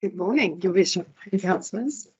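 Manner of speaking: hoarse, strained voice; losing voice. Real, not acted. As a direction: voice going hoarse. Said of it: "I'm losing my voice today".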